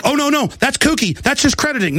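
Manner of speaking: high, fussy mock dismay; mock objectors. Host mimicking critics of getting angry, high fussy mockery. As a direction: imitate timid critics tutting. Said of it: "They're like".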